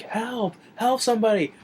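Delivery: high, hammed-up call for help; villain pretending the dog attacks him, crying out high; mock cry for help. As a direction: fake a panicked plea, pitched high. Villain fakes being attacked by dog.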